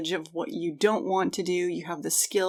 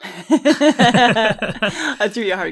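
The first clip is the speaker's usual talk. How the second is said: laughing; 3 semitones higher, 8 dB louder, much slower than usual. Real, not acted. Teasing co-host after tough question.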